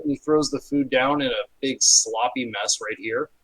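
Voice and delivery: usual speaking voice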